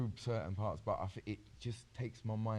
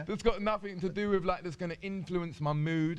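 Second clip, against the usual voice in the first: laughing; 10 semitones higher, 5 dB louder than usual. Real, not acted. Laughing through the explanation.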